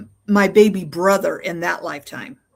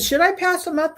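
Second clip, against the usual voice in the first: higher-pitched quoted question to the group; retold eager question; 9 semitones higher than usual. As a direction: pitch lifted high, asking the passengers. Retold question to car passengers.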